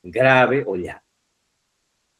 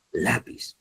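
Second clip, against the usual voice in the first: "lápiz" with LA stressed, said softer; stress on first syllable; 2 semitones lower, 8 dB quieter than usual. Shown as a demonstration. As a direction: lean on the A of "lápiz". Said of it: "Lleva la sílaba tónica en la letra A".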